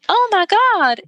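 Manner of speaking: mock shock. [name] realizing the horror.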